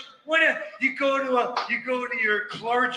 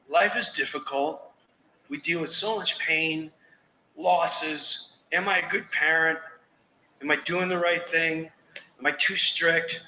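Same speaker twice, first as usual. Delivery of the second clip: mock heartfelt worry; 6 semitones lower than usual. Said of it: "those are moments like".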